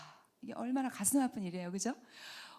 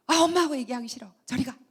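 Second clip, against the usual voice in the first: put-on a child's annoyed snap at mom, quick and louder; sulky kid pushing mother away, irritated; 7 dB louder, faster than usual. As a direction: push mom away, fed up and whiny. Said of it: "막 짜증을 내요".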